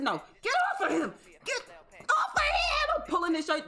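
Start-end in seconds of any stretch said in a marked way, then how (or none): 0.0-2.5 rising to a high cry of get off of him; retold yell. Scene: pulling the man off the victim, high shout. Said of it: "I said".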